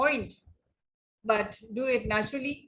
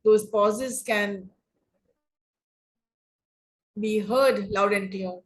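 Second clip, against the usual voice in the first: long meaningful silence after CAN, then resume; dramatic pause. Shown as a demonstration. Speech coach showing students a meaningful pause, holding silence after "can". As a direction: stretch "Those pauses can", stop dead, then "be heard". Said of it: "those meaningful pauses".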